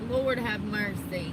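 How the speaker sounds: ordinary talk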